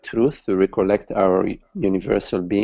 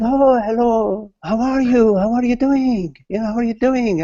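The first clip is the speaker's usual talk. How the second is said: cheery greeting; 11 semitones higher than usual. Acted. Friend greets man in cafe.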